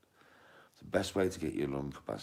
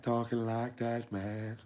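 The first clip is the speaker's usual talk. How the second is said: high, drawn-out voice; high-pitched, stretched-out words; high strained voice; 8 semitones higher, slower than usual. Shown as a demonstration. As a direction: talk slowly way up high. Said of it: "you're gonna be like".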